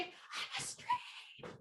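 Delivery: long, drawn-out whispered shout; whisper yell. Shown as a demonstration. Leading everyone on the count of three, whisper yell. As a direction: yell it in a slow, voiceless whisper. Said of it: "you're going to whisper yell".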